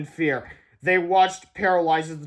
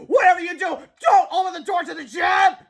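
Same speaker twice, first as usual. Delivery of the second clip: high, loud shouted plea; urgent warning; 13 semitones higher, 7 dB louder than usual. Acted. George warning the teachers, high loud shout.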